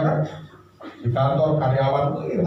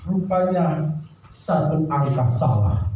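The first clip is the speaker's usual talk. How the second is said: usual speaking voice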